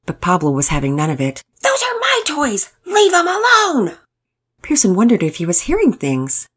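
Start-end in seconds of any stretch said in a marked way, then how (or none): 1.6-4.0 put-on high-pitched possessive child's outburst; indignant child. A narrator's reading. Pablo warns Pearson off his toys, indignant high child voice. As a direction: squeal it as a little boy guarding his toys.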